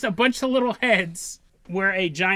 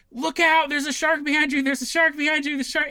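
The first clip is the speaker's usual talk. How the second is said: calling out; 6 semitones higher than usual. Retold or imitated. Maggie yelling a warning.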